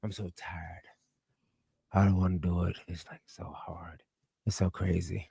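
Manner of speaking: soft, exhausted whispering; mock tired whisper. To friends, mocking his own fatigue, tired whisper. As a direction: whine it out like you're worn out. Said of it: "I said".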